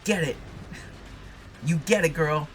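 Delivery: egging her on like a ringside fan; excited cheer for the heroine. Real, not acted. Cheering on in-game fight.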